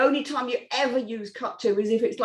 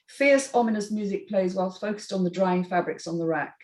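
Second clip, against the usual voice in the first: ordinary delivery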